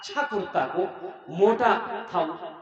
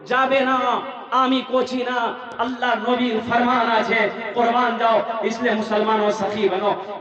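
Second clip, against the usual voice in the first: loud rallying sermon voice; full-force preaching; 11 dB louder than usual. Real, not acted. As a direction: exhort the congregation loudly.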